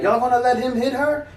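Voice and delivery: high, indignant lady's voice calling out; Haitian lady. Retold or imitated. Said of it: "Haitian lady or something like that".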